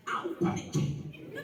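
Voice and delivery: high, slow, breathy prophet's threat; hammed-up prophet voice; mock prophet threat.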